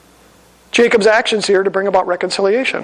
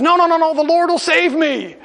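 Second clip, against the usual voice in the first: stranded man turning down the boat, self-assured; stubborn refusal; 8 semitones higher than usual. Acted. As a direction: wave the rescue away in pious certainty.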